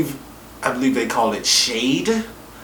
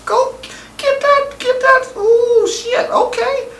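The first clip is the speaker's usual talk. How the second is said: squealing high, egging them on; high-pitched cheering. Retold or imitated. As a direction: shriek like a cheering fan. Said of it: "I'm like".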